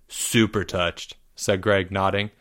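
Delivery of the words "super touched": slow deadpan. Acted. Greg deadpans reply.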